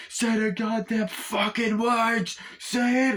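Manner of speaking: very high, drawn-out insistence; exasperated demand. Acted. Gunman pressing wounded partner harder, exasperated demand. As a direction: push harder, stretching every swear word. Acted demand escalates, with added swearing.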